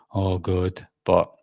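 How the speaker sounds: ordinary talk, nothing marked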